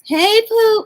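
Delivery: high, loud corrected hey Pooh; Foodie's greeting. Retold or imitated. Fixing her imitation of the streamer's greeting, high. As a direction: land Pooh up high and bright. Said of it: "It's not like that. It's".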